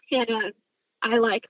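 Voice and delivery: ordinary delivery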